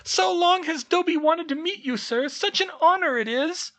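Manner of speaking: very high, loud, squeaky elf voice; a tiny servant gushing with awe; eager house elf. Acted. House elf meets Harry, squeaky and adoring. Dobby's voice held.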